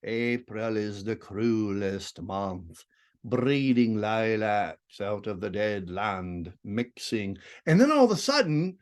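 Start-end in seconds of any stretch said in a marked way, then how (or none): 0.0-7.4 slow, soft, low and dark recital; deep brooding voice. Shown as a demonstration. Teacher contrasting the poem's voices: slow, low, brooding reading. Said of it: "We go from this deep".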